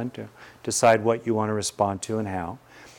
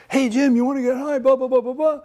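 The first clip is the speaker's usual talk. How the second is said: high, loud, rambling Richard Pryor voice; fast high patter, dodging the question; a teacher hams up a comic pestering his friend; Richard Pryor impression; 14 semitones higher, 4 dB louder than usual. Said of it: "So Richard is basically doing this".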